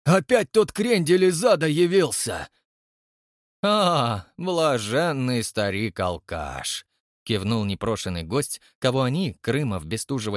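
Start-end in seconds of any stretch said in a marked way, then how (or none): 0.0-2.5 put-on exasperated old man. Yegor Kuzmich exclaims. 3.6-6.8 mocking villain. Koshchei mocks.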